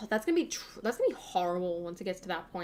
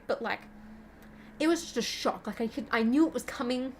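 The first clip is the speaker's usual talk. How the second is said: ordinary talk, nothing marked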